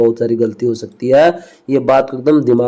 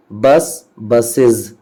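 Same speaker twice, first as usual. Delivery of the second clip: ordinary talk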